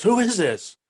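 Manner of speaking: retold panic. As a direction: imitate a panicked girlfriend, pitch jumping up. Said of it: "And she's panicking".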